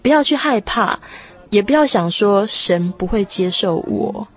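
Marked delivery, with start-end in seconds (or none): none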